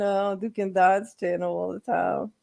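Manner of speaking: ordinary talk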